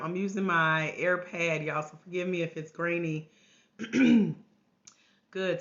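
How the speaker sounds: usual speaking voice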